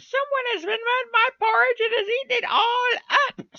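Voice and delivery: very high, loud squeaky tiny bear; wee little small voice. A narrator's reading. Wee bear finds his porridge all eaten, high tiny squeal. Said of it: "in his wee little small voice".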